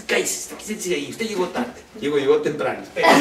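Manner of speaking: regaño de anfitriona, con voz cambiada; mock bossy hostess. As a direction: manda al invitado en broma. (anfitriona regaña en broma al invitado puntual)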